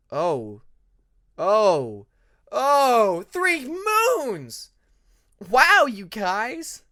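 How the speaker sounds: slow wow of disbelief; dawning realization. Acted. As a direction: let it dawn, then burst out.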